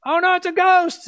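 hammed-up shriek of the frightened disciples; mock fright. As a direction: play the scared disciples crying out. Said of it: "they're freaking out".

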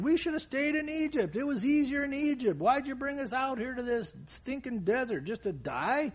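high-pitched imitation of grumblers; mock complaining. Israelites grumbling against Moses in the desert, mock complaining. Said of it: "They complained".

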